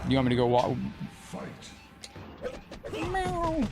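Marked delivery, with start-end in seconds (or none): 2.9-3.7 high, soft playful meow back at the cat. Pretend. Streamer meows to his cat Taki, high, soft and playful. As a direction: meow like a kitten to call him.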